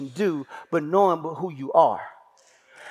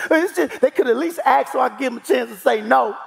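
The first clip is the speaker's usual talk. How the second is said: put-on whiny little boy; 6 semitones higher than usual.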